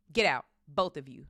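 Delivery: forceful croak at normal pitch. A narrator's reading. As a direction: order them out in a croak. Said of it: "croaked Uncle Vernon".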